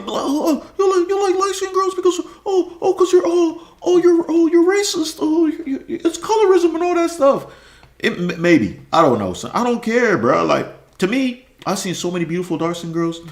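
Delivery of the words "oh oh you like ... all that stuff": high, squeaky mock accuser with laughter. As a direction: mimic the critics whining, higher than his own voice. Mocking critics' accusations.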